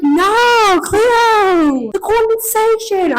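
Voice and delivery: very high, loud Australian wail; hammed-up Australian panic, vowels dragged out; mock Australian accent. American imitating her friend complaining, shrieky parody Australian accent. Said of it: "it's exactly what you sound like".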